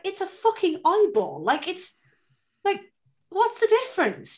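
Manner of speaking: high, slow, loud incredulous protest; exasperated disbelief. Retold or imitated. Telling her husband it's only an eyeball, high and exasperated. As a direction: drag it out, baffled he's objecting.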